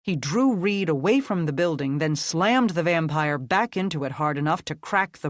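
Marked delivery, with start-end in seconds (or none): none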